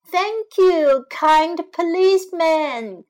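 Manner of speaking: high, loud thanks; old lady thanking the policeman loudly; cried out thanks. Acted. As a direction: call out your gratitude. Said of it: "cried the old lady".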